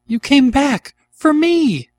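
very high, loud overjoyed cry. A narrator's reading. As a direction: cry out to a returning love. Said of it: "cried Anne".

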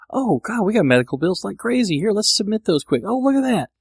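high-pitched eager realization; acted excited discovery. Host replaying finding unclaimed bills, excited discovery. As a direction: play up a sudden happy find, voice jumping up.

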